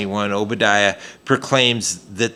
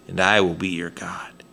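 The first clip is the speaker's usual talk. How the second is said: a hushed whisper, lower and much softer; whispered promise; 7 semitones lower, 8 dB quieter than usual. Acted. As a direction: breathe the line like a sacred secret. Reading God's promise aloud.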